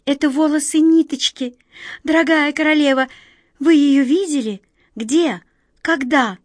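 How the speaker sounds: high, loud eager questions. A narrator's reading. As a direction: press her anxiously for news. Young man eagerly questions queen.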